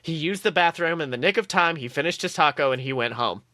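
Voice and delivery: ordinary talk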